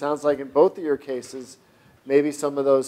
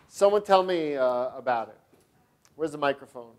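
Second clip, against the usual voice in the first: ordinary delivery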